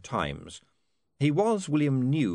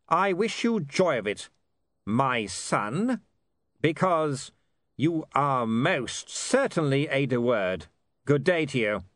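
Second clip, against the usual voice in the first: higher, measured voice of a proud father; proud lord; 6 semitones higher, 3 dB louder, slower than usual. Acted. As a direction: bid your son a stiff, deliberate farewell.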